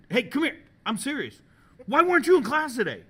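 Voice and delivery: high, loud demand that builds; mock-stern shouting; imitated teacher's confrontation. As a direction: act out a teacher hauling a student back.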